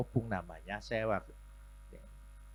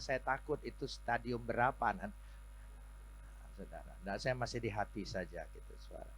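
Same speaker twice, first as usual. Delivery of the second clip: ordinary talk, nothing marked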